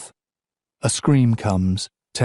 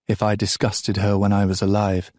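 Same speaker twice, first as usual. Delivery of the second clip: ordinary delivery, nothing marked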